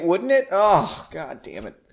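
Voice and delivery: ordinary delivery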